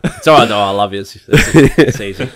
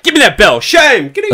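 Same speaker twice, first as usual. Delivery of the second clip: high, loud public shaming; hammed-up bell-ringer's proclamation; mock town-crier shouting; 12 semitones higher, 6 dB louder than usual. Grabbing the town crier's bell, mock shaming shout. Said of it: "There's, like, the town crier walking around".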